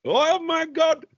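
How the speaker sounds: high, slow, drawn-out "Oh my God"; mock Indian accent. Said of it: "That's, that's how he talks".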